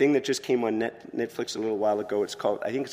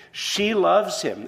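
stretched out, high and loud, full of feeling; preacher swelling with emotion; fervent slow emphasis; 8 semitones higher, 6 dB louder, much slower than usual. Real, not acted. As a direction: linger on each word as if overwhelmed.

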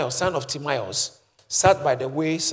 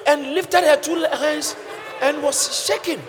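high, loud excited telling; excited preaching; 10 semitones higher, 7 dB louder than usual. Real, not acted. Preacher retelling a joyful church moment, excited. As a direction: build the joy up.

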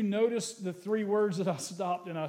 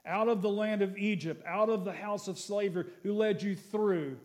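scripture reading; 3 semitones higher than usual. Acted. Pastor reads the promise of the land to the church, proclaiming. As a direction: lift the verse to the room.

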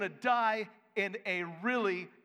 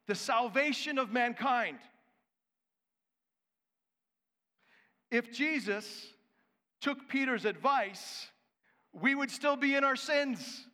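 preaching; 6 semitones higher than usual. Acted. Preaching at full force.

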